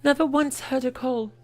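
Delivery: put-on high, fast, girlish and worried; young heroine. Young oracle telling friends her worry, high and fast. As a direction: let the relief tumble out quickly.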